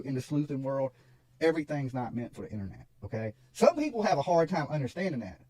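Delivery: ordinary talk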